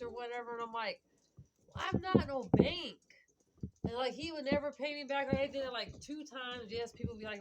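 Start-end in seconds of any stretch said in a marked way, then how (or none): 1.7-3.0 louder, higher and slower retold retort; emphatic retold comeback; indignant refusal. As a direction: put your foot down, spelling it out. Retelling her refusal of money.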